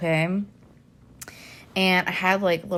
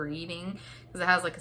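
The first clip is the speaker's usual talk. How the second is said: ordinary talk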